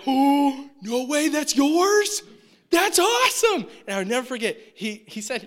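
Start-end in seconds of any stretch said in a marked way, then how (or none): 0.1-3.7 high, loud, drawn-out amazement; amazed excitement. Retold or imitated. College kid seeing a customer's new Jaguar, thrilled. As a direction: gush over the car like a kid.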